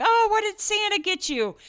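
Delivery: high, bright mock-innocent question; sing-song play-along. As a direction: play innocent for the little one. Mom playing along with Santa.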